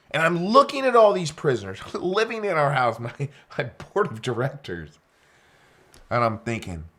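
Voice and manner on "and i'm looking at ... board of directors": laughing through the words, pitched up; amused laugh at the absurd situation. Real, not acted. Laughs at prisoners in house.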